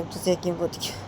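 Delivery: ordinary delivery, nothing marked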